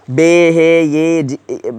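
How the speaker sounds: slow, drawn-out বে হে ইয়ে জি-, he as its own letter; stretched letter names with a separate হে; wrong spelling-out. Shown as a demonstration. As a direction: spell বে হে ইয়ে জি- slowly, breaking off. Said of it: "আমরা এইভাবে বলবো না".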